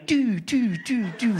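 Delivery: high, falling "doo" repeated; comic high-pitched spurting noises; imitated squirting. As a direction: mimic little spurts one by one. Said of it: "it's going".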